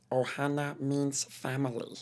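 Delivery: high, slow cartoon alien voice; slow high-pitched cartoon creature; Stitch. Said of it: "Stitch, you know, is like".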